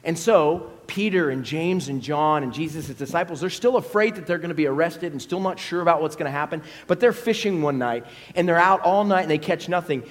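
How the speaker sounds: ordinary delivery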